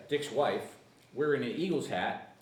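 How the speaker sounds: normal talking voice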